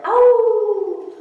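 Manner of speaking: high, loud howling "Ow"; wolf howl. A narrator's reading. Wolf howls at Theodore outside the cave, loud howl. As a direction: throw your head back and howl like a wolf. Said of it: "It howled at Theodore".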